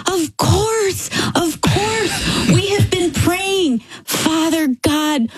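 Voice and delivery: high, slow, exclaiming without volume; mock yell. Son-in-law telling her they have been praying, high mock yell. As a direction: retell an overwhelmed outcry, pitched high and stretched out. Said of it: "he yells".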